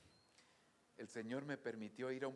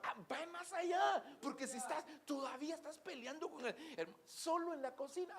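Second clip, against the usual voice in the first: preaching at full force; 12 semitones higher, 6 dB louder than usual. Real, not acted. Preacher calling the church to applaud, at full force. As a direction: proclaim it to the whole church.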